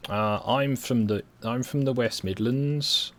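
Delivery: slow Brummie accent drawl; slow Birmingham accent, West Midlands vowels. Shown as a demonstration. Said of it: "this is the Birmingham accent".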